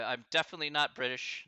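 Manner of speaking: usual speaking voice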